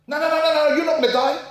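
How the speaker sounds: hammed-up cheerful host brushing it off; bubbly presenter. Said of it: "the bouncy presenters".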